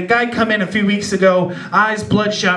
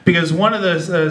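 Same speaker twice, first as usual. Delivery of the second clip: usual speaking voice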